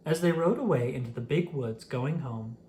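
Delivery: ordinary delivery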